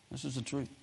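ordinary talk

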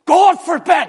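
explosive, high-pitched shout of refusal; emphatic. Acted. Paul turns on his admirers, emphatic shout. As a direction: cry it out loud as an outraged rebuttal. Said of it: "Paul emphatically turns around and says".

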